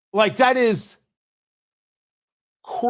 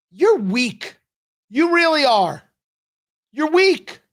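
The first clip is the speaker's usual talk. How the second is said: high, sneering "You're weak"; taunting; 7 semitones higher, slower than usual. Real, not acted. Radio host mocks the congresswoman, sneering. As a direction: jab at her with scorn.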